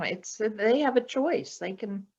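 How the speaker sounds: ordinary delivery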